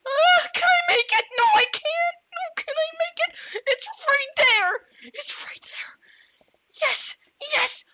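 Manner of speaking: squeaky high desperate wailing; high mock panic. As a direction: whine it like a panicked cartoon. Fake desperate escape.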